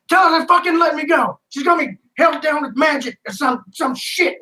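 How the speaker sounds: freaking out while pinned down; held bartender begging to be let go; panicked bartender. Acted.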